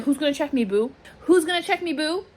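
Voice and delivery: high-pitched mock challenge; playful boast. Crowing about the new space, playful boast. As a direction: throw it out as a high, playful dare.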